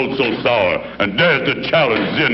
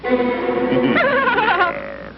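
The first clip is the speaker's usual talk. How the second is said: evil laugh; 8 semitones lower than usual. Acted. Villain gloats.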